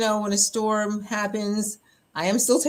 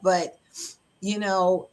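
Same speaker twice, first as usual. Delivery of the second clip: usual speaking voice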